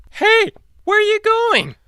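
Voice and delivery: very high loud shout after them; high cartoon call. T-Rex calls after the brothers, very high loud. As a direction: holler like a frantic cartoon monster.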